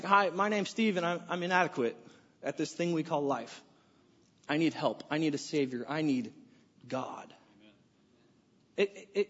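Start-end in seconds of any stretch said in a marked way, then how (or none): 0.0-7.3 softer, quietly confessional, sinking lower toward "God"; humble confession. Retold or imitated. Confessing inadequacy at a meeting, hushed and humble. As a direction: own up to it like at a support meeting.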